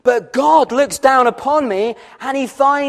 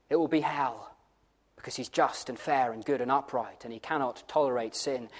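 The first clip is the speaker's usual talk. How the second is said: very low, hushed pronouncement of judgment; grave warning; 10 semitones lower, 13 dB quieter, faster than usual. Real, not acted. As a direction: drop right down, deadly serious.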